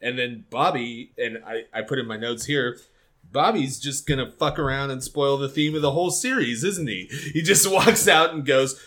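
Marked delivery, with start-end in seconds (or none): none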